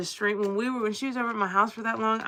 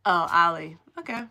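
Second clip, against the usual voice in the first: ordinary talk, nothing marked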